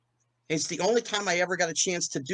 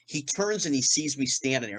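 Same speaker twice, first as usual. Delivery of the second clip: ordinary delivery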